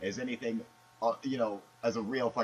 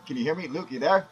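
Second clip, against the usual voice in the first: normal talking voice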